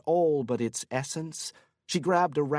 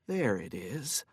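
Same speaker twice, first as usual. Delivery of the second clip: quiet; 4 semitones lower, 6 dB quieter than usual. Acted. Whispered discovery.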